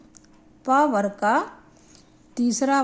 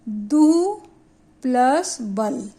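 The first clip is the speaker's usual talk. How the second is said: दु and बल drawn out separately, very deliberate; slow dictation; 3 dB louder, much slower than usual. Shown as a demonstration. Teacher dictates the next sandhi example to pupils, slow and deliberate. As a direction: read out दु plus बल slowly, part by part.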